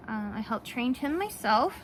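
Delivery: normal talking voice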